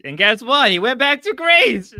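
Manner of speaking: loud, high, triumphant reveal; crowing. Real, not acted. Streamer crowing that the prediction came true, triumphant. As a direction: shout the punchline out in triumph.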